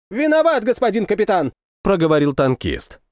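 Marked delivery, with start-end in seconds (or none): 0.1-1.5 high-pitched, hurried apology; scolded subordinate. A narrator's reading. Tankman apologises to shouting captain, scolded subordinate. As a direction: answer up like a soldier being chewed out.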